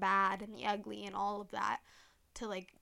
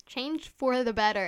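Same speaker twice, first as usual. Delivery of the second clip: "Change for the better." said again louder and firmer; louder repeat; 2 semitones higher, 9 dB louder than usual. Real, not acted. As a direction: proclaim "Change for the better." as a rallying send-off.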